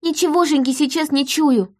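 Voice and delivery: put-on a high, understated child's voice, not a true whisper; whispering daughter. A narrator's reading. Daughter admitting to mother she senses nothing, high child voice. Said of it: "прошептала она".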